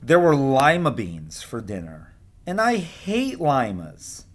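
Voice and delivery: lively picture-book read-aloud; storybook voice for young kids; reading to children. A narrator's reading. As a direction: read the story to your class with expression. Teacher reads picture book aloud.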